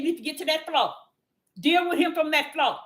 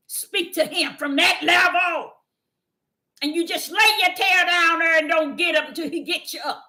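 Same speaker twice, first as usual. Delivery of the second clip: preaching shout. Acted. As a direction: urge them down to the floor with a raised voice. Preacher exhorting the floor.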